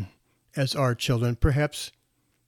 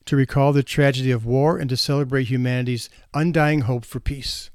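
usual speaking voice

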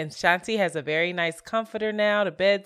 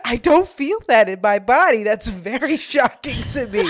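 higher-pitched mock solemn protest; sleepy earnest shock; 4 semitones higher, 6 dB louder than usual. Imitating a friend denying she snores, mock shocked. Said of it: "she was still asleep when she said it".

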